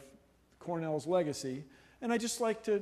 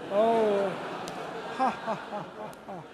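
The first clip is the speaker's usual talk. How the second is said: mock laugh; 4 semitones higher, 4 dB louder than usual. Fake laughing at April fool picture.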